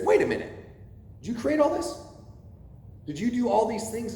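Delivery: a pointed rhetorical challenge that puts Job in his place; God's rebuke. Retold or imitated. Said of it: "gives him one of those backhands and says".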